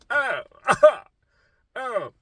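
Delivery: hammed-up anguished "oh"s; mock distressed groans. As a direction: recoil out loud from something horrible. Acting out reaction to ugly symptom.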